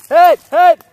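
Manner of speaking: very loud high yell; shouting hit. Real, not acted. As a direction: bellow it out to be heard. Calling himself out, hit.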